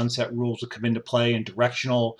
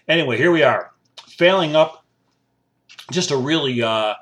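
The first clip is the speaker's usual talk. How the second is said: louder, punchy show-name announce; radio host voice; 3 semitones higher, 5 dB louder than usual. Acted. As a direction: hit the show title like a DJ.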